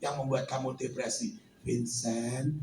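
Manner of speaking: the Lord speaking low and soft to Vincent; voice of God. Acted.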